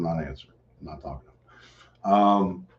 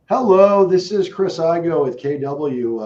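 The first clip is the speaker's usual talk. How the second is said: high, loud sales hello; confident sales hello; phone greeting voice; 8 semitones higher, 8 dB louder, slower than usual. Real, not acted. Cold call greeting.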